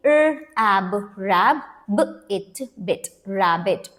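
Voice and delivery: broken into sounds, then blended into "rabbit"; sounded out. Shown as a demonstration. Teacher shows children how to read rabbit, sounding it out. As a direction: blend "र, ab, rab, b, it, bit" piece by piece, then "rabbit". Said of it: "तो कैसे read करेंगे".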